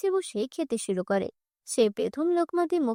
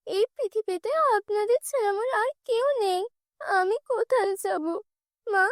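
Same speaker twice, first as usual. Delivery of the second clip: put-on pleading girl; 7 semitones higher than usual. Girl begs not thrown out.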